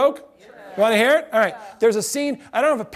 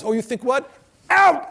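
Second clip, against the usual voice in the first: mock professor yell; 5 semitones higher than usual. Professor acting out his only job, mock yell out. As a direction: throw them out of class. Professor mimics kicking student out.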